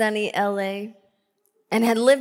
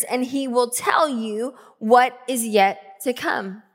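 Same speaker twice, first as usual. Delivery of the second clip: measured, weighty verse reading, raised and loud; deliberate pulpit proclamation; emphatic scripture reading; 4 semitones higher, 6 dB louder than usual. Real, not acted. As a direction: read it out as a firm promise. Reads scripture with force.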